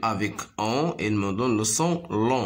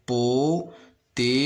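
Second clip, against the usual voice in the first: "Potiron" slow, in separated beats; stretched syllables with gaps in "Potiron"; slower than usual. Shown as a demonstration. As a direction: sound out "Potiron" as po, ti, ron. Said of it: "se compose de trois syllabes".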